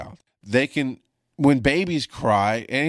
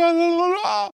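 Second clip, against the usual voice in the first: a much higher shout breaking into a cry; cracking shout. Retold or imitated. Husband yelling at frantic wife on phone, voice cracking. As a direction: blurt it out, voice cracking. Retelling panicked call with wife.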